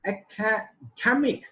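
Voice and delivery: "academic" slowly in high pitch, leaning on "dem"; syllable stress demonstration. Shown as a demonstration. Teacher showing primary stress to students, "academic" slow with "dem" emphasized. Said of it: "I emphasize the while pronouncing it".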